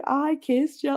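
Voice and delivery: high-pitched mimicked boast; mocking taunt. Mimicking a co-worker bragging all day, mocking.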